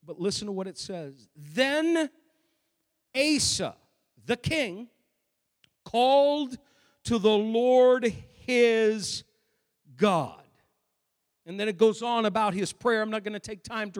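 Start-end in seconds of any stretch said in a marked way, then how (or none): 1.3-10.4 slow, word by word with long pauses; solemn scripture reading. Acted. Reading scripture aloud for emphasis.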